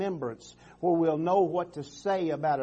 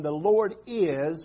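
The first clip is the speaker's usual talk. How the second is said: IS drawn out slowly; stress on IS; much slower than usual. Shown as a demonstration. Preacher pointing the congregation to present tense, stress on IS. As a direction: lean long on "is". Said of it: "present tense".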